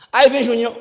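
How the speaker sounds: ordinary talk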